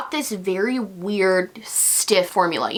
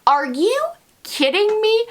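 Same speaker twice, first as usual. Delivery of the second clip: high, disbelieving rise; can't believe it, rising high; incredulous; 13 semitones higher than usual. Real, not acted. Outraged at tiny product.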